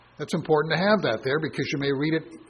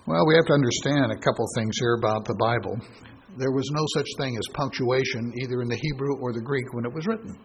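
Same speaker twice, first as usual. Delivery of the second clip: ordinary delivery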